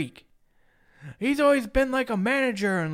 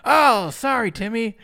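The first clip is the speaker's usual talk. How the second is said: loud, higher, mock-apologetic preacher; hammed-up pulpit apology; mock pastor; 3 semitones higher, 8 dB louder, slower than usual. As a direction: exclaim a showy fake apology. Pretend pastor rejecting a child.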